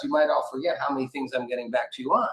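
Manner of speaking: normal talking voice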